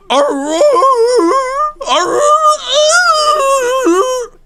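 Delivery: high, loud whimpering bark; whining bark imitation. Mimicking the neighbour's dog, a loud high whining bark. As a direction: whine each bark up high like a sad dog. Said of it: "It, like, whines as it barks".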